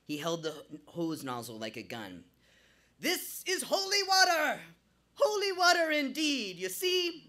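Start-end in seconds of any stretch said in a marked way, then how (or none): 3.0-7.2 very high, loud Jamaican shout; frantic Jamaican shouting. A narrator's reading. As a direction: rant wildly in Jamaican accent. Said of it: "rambling even louder".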